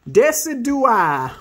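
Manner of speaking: Decidueye drawn out high, then falling; confident pronunciation. Real, not acted. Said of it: "I wanna try to pronounce it".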